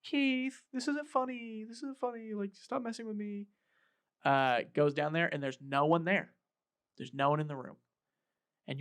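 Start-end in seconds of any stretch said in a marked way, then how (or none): 0.0-3.5 much higher, uneasy pleading call; spooked but trying to sound brave; nervous calling out. Retold or imitated. Scared woman calling in basement.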